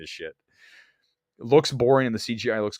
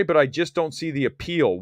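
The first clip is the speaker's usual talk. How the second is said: normal talking voice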